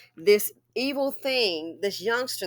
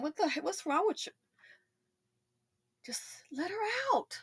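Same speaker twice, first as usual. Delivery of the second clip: breathy pleading whisper, higher; 8 semitones higher, 10 dB quieter than usual. Real, not acted. Pleading with the driver in a whisper. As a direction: hiss it out under your breath, begging.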